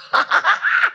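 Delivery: high, quick burst of laughter; laughing. Real, not acted. Preacher overjoyed by the scripture, laughing high. Delighted at the verse.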